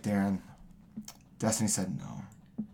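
low, quiet fake gloom; mock sad. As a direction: deliver fake bad news softly. Fake bad news, prank.

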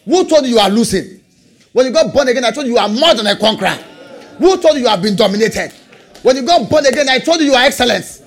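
rhythmic preaching building to a high cry; full-force preaching. Real, not acted.

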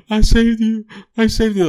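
high-pitched imitation of tearful relief; overcome, squeaking it out like a hammed-up sob; mock bawling. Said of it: "the dude starts bawling immediately".

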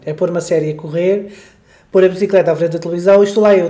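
ordinary talk, nothing marked

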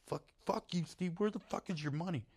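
high-pitched mock exasperation; retold angry retort. Storyteller demanding where the friend's money went, high exasperated retort. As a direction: fire back high without raising the volume. Retold phone quarrel over the paycheck.

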